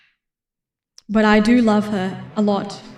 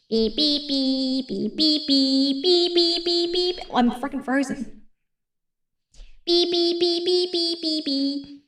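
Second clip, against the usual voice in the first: quiet beeping; 10 dB quieter than usual. Real, not acted. Vocalizes beeping noises softly.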